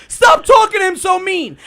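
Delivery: loud scolding quote; mock shout. Fan scolding the host, a loud mock shout. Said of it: "they go".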